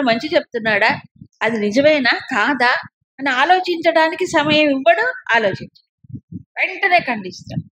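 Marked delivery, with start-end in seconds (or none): none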